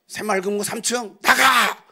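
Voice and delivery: slow shouted get-out order; mock angry shout. A shopkeeper chasing out an evangelist, shouting. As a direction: yell them out of the building.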